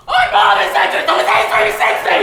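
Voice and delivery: very high, loud shrieking plea; urgent yell. Acted. The two pigs shriek at their neighbor's door. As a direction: scream to be let in. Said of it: "with urgent hoofs they pounded".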